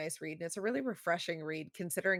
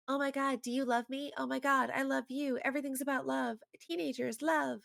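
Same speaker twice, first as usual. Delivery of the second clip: put-on high-pitched gushing lovesick teen; mock teenage angst; 8 semitones higher than usual. Mocking teen romance manga, angsty and gushing. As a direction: swoon like a melodramatic teenager. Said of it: "the teenage angst isn't like".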